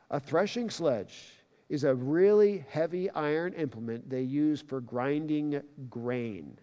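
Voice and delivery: ordinary talk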